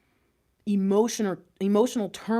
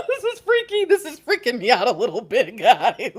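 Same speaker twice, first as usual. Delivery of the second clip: laughing shout; 3 semitones higher, 4 dB louder than usual. Real, not acted. Reader telling viewers the card spooks her, laughing. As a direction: shout it through nervous laughter.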